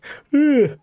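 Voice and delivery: high mock yawn sound; high little yawn noise; imitated yawn-burp. Shown as a demonstration. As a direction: act out a yawn ending in a tiny burp. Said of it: "That could be me".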